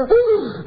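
sharp high "ah" of alarm; high shocked gasp. Acted. Preacher acting shock at a grave prayer request, high gasp. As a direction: gasp as if struck by bad news.

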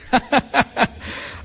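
short unvoiced chuckle; a quick amused laugh under the breath; breathy laugh. Real, not acted. Laughs at David's danger.

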